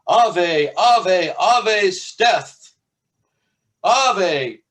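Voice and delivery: high priest shouting at the storm wall; shouted invocation. Acted. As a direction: hail the god at full voice. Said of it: "and shouted".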